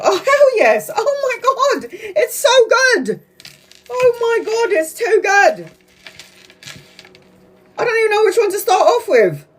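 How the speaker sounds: very high, squealing with delight; over the moon about the cards; thrilled squeal. Real, not acted. Thrilled by the reading.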